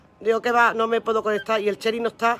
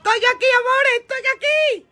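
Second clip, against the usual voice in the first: high-pitched, singsong call to the audience; 9 semitones higher, 3 dB louder than usual. Real, not acted. Streamer tells her followers she is here, calling out.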